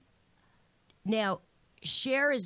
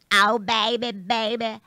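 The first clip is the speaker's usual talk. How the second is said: louder, fuller pop-star coo; sing-song like a peach in your mouth; breathy pop-star impression, pushed harder; 3 semitones higher, 14 dB louder, slower than usual. Shown as a demonstration. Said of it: "I imagined having a peach in my mouth".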